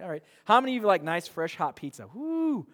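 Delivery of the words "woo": high whoop, much higher; playful whoop; excited whoop. Pretend. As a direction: cheer for the pizza. Excited about pizza.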